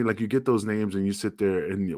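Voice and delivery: ordinary talk, nothing marked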